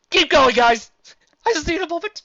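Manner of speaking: high, loud comic weepiness; hammed-up tearful plea; mock choked up. A narrator's reading. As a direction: wave them on, overcome with emotion. Overcome by the sad parting.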